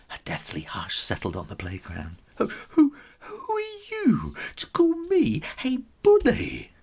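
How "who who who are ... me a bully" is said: much higher, indignant stammer; hammed-up offended bully; outraged bully. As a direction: splutter with offended fury.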